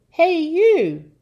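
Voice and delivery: high, loud accusing call. Acted. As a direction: call out sharply to someone across a yard. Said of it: "called a servant girl".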